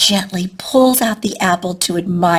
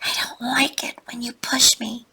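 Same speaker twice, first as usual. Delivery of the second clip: small scared whisper, softer; frightened child whispering his line; tiny whisper; 4 semitones higher, 4 dB quieter than usual. A narrator's reading. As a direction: barely dare to say it. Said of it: "whispers in a little tiny voice".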